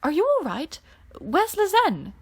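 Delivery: anxious check on a friend; concerned. Acted. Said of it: "eyed him with concern".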